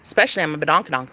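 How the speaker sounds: ordinary talk, nothing marked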